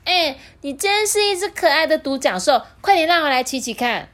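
high, loud demanding princess; a spoiled princess begging to ride; bossy princess. A narrator's reading. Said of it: "她立刻就说".

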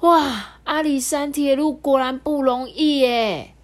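high, loud, wonderstruck; little train impressed after the climb; amazed. Acted. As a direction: marvel at the journey. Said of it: "蹦极蹦极就说".

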